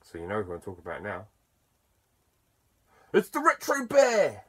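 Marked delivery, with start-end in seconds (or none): 3.1-4.4 hyped announcer reveal. Acted. Vlogger unveiling a friend's channel name, hyped announcer cry. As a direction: call out the big reveal in a high, excited voice.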